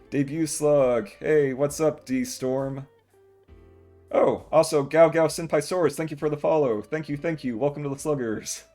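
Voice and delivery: loud, bright welcome to chat; excited streamer greeting. Real, not acted. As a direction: greet the new follower loudly.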